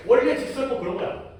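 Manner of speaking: ordinary talk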